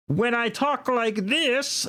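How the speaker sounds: high, slow cartoon voice, a little like Kermit; Power Rangers character voice. Shown as a demonstration. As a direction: put on a high-pitched show-character voice, drawing the words out. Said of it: "When I do a voice, I'm actually emulating a character".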